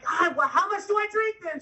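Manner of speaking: high-pitched indignant retort; mock exasperation. Husband challenged by wife before doctor, mock exasperation.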